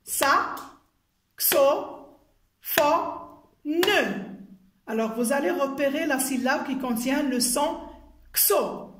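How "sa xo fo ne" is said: sa, xo, fo, ne as isolated beats; syllable by syllable. Shown as a demonstration. Teacher clapping out syllables with pupils, one at a time. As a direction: tap out each syllable with long gaps. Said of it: "Tapez avec moi les syllabes".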